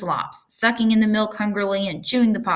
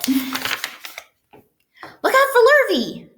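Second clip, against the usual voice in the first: much higher, very slow drawn-out warning; calling cows; 3 dB louder, much slower than usual. A narrator's reading. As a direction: stretch the warning like cows mooing. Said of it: "called the cows".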